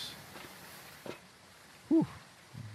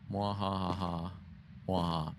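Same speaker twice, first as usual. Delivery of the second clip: villain laugh; 4 dB quieter, slower than usual. Pretend. Villain laugh after the tease.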